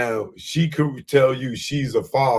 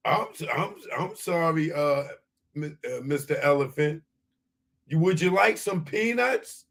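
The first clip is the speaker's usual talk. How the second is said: mock scared; 7 semitones higher than usual. Mock fearful apology to elephant-dog.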